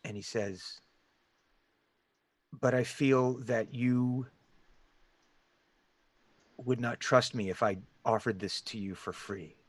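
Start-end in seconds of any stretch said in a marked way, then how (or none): 2.6-9.5 soft, deliberate hooded stranger; deep gravelly voice. Acted. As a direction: lay out the bargain quietly, with a long pause. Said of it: "it's in this deep, gravelly voice".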